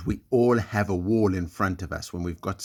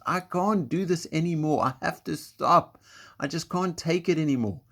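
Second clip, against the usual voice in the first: higher, whiny voice of giving up; discouraged inner voice; 7 semitones higher than usual. Retold or imitated. The voice in your head telling you to stop, whiny and defeated. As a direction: play the tired little voice that wants to quit. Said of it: "there's a voice in your head and it just goes".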